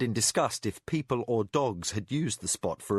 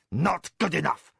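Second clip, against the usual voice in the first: curt; 6 semitones higher, 4 dB louder than usual. Acted. Spy forcing the door.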